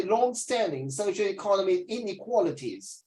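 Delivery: "long-standing socioeconomic inequalities" said in careful British English; British pronunciation demo. Shown as a demonstration. Teacher showing the student how to say it the British way. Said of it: "You should say".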